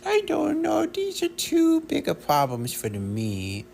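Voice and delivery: very high, loud squeaky dog voice; voicing the dog. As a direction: speak for the dog in a tiny high voice. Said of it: "She says".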